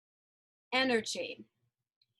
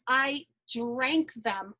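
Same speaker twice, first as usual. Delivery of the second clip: usual speaking voice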